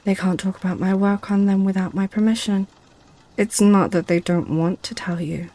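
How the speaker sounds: usual speaking voice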